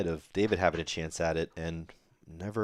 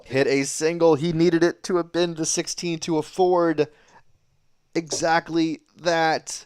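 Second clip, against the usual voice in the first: louder and higher, building urgency; excited commentary; 7 semitones higher, 7 dB louder than usual. Real, not acted. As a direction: press the point with energy. Commentator explains missed chance.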